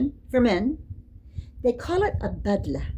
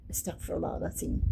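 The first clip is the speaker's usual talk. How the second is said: whispered prayer; 3 semitones lower, 11 dB quieter, much faster than usual. Real, not acted. Muttered prayer at unseemly sight.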